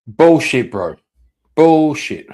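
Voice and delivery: loud, disgusted scoff; scoffing outburst. Real, not acted. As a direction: call it out loudly, incredulous. Reactor scoffing at interviewee.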